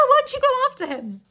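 ordinary delivery, nothing marked

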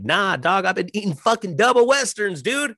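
high, chirpy mock compliment; imitating friends. Friends complimenting his weight loss, high and chirpy. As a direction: squeak out fake praise, pitched high. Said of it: "I'm like".

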